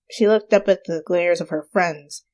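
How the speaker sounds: usual speaking voice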